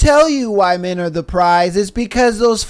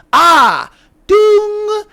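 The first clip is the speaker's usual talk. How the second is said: triumphant ding; 12 semitones higher, 5 dB louder than usual. Acted. Gotcha bell.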